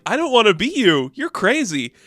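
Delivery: shaken refusal. Retold or imitated. Defeated Randall refusing to be Mark, high and shaken. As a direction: back away from it.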